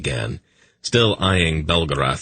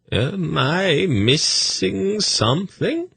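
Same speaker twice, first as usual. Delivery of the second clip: much higher, slow, dreamy curious eunuch; high, slow, dreamy eunuch voice asking innocently; 13 semitones higher, slower than usual. A narrator's reading. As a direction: float the question up, airy and curious. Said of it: "asked curiously".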